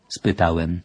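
usual speaking voice

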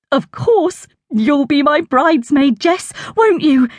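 high, delighted aunt asking sweetly; excited aunt voice. A narrator's reading. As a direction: beam at her as the thrilled aunt. Aunt asks Jess to be bridesmaid.

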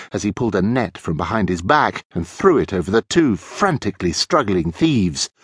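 ordinary delivery

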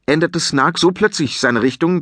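plain reading voice

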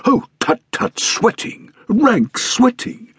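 high, very slow, hammed-up indignant cry; mock outrage. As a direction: throw up your hands in theatrical passion. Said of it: "he cried ... in a boiling passion".

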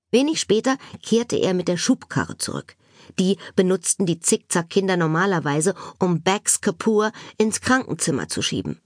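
usual speaking voice

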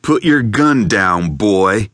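a hint of a Southern accent, slow and loud; lightly voiced Southern gunman, slow; slow southern drawl. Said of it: "with a slow southern drawl".

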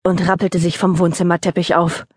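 usual speaking voice

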